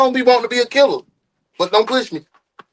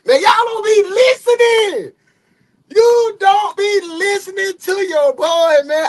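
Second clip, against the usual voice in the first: exasperated shout; 12 semitones higher, slower than usual. Real, not acted. Complaining loudly to the chat.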